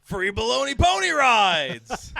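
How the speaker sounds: mock ad pitch. Mock tourism slogan.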